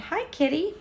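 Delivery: high, soft pet voice; talking to the cat. Real, not acted. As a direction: coo at the kitty. Greeting the cat.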